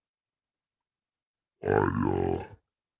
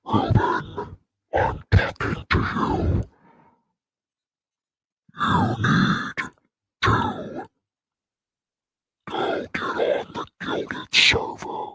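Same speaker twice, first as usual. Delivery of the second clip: breathy unvoiced whisper, slow; creepy whisper; slower than usual. Streamer haunts Ruben with a slow creepy whisper. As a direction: whisper to Ruben like a ghost at his ear. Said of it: "this is creepy".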